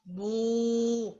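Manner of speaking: low, long cow lowing; cow moo. Shown as a demonstration. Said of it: "cow says".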